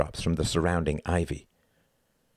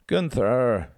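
drawn-out, higher woman's moan; plaintive, raised moan; 6 semitones higher, 3 dB louder than usual. A narrator's reading. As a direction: moan the name wearily. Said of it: "she moaned".

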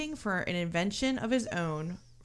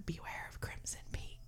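hushed, unvoiced-sounding whispered warning; ghostly mother's whisper; 16 dB quieter than usual. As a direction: whisper it like a spirit in a child's ear. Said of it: "her mother whispers in her ear".

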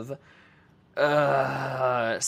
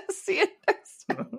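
laughing squeak. Pretend. Laughing sign-off in high voice.

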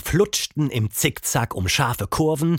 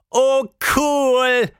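lachend; 13 semitones higher, 4 dB louder than usual. A narrator's reading. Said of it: "lachte Tilda".

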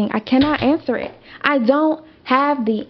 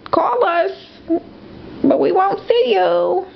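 mocking the police; 8 semitones higher than usual. Police brushing off theft call.

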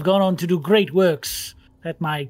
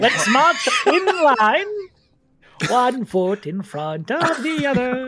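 pompous professor; 7 semitones higher, 5 dB louder than usual. Acted. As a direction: lead the march like a fussy scholar. Professor character leads march.

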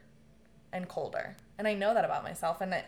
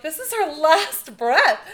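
louder, higher, slow alarmed cry; panicked; 8 semitones higher, 13 dB louder, slower than usual. Retold or imitated. The family panicking around her bed, alarmed. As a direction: sound the alarm in disbelief.

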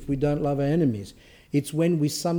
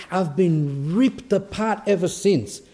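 higher, slow, hammed-up distress; upset; 4 semitones higher, slower than usual. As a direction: imitate an aggrieved complainer, drawing it out. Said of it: "I'm so upset".

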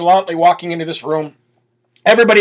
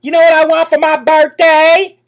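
a higher, drawling Southern accent mocking her; 9 semitones higher than usual. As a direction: put on a fake Southern accent, teasing. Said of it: "a little bit of a Southern drawl".

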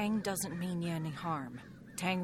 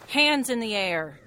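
loud, firm order at gunpoint; barked command; 2 semitones higher, 8 dB louder than usual. Acted. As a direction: command them to freeze, loud. Emelina holds shotgun on Tang.

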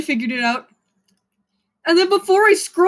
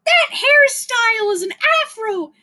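put-on much higher sneering girl voice; imitating a twelve-year-old girl lecturing about a hairstyle, much higher; mocking girl voice; 10 semitones higher than usual. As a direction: mimic a preachy twelve-year-old, shrill and sure. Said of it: "girl is gonna fucking find it and say".

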